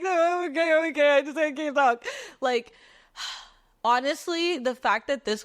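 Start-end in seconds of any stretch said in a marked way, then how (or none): 0.0-2.0 imitated high-pitched hysterical whimper; tearful brush-off. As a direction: wail it while waving off concerned strangers. Sobbing reply to worried strangers.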